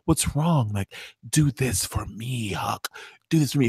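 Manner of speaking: low, soft, lightly acted coaxing; imitating Huck's handler. Recapper acting out Olivia pleading with Huck, low soft coaxing. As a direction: quietly coax a reluctant accomplice, half in your own voice. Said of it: "Huck is just like".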